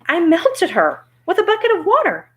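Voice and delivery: put-on high-pitched girl's answer; eager Dorothy. Dorothy tells Oz about the witch, high-pitched.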